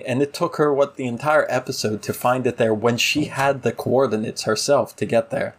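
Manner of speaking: ordinary delivery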